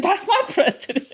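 proud declaration. Retold or imitated. Man boasting to a stranger about his president, high and proud. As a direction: announce it like a boast. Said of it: "and I said".

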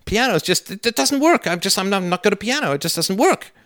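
high, whining excuse-maker voice; mock whiny excuse. Son mocking his own lame excuses to dad, high and whiny. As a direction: whine like a kid dodging practice. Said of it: "And then I say".

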